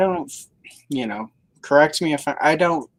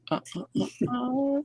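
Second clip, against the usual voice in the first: silly high sound; 12 semitones higher, 5 dB quieter than usual. Real, not acted. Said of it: "Sorry, that slipped out".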